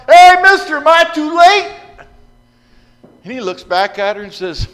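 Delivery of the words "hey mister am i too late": loud high call to the garbage truck; woman in curlers yelling to the garbage man. Acted. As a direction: shout after a departing truck. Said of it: "she yells out".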